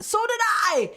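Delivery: high-pitched burst of agreement; excited outburst. Real, not acted. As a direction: blurt it out high, suddenly excited.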